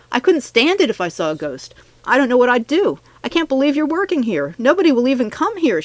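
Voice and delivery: high, agitated protest; creeped-out student, pitched high; spooked freshman. A narrator's reading. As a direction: protest with nervous disbelief. Frightened freshman explains the haunting.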